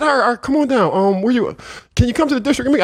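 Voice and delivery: high, eager officer voice; officer inviting him down, high and eager. Retold or imitated. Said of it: "he said".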